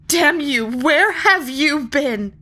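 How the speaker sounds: high and loud, slowing on the question; angry outburst. Acted. Molly rages at the unicorn for coming so late, loud. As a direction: hurl it at her, furious.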